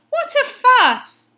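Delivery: high, loud exclamation of protest; exclaiming. A narrator's reading. Benny to the angrily mooing cows, high exclamation.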